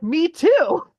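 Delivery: high, loud eager cry; excited. Retold or imitated. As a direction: blurt it out like a thrilled fan. Excited fan reaction.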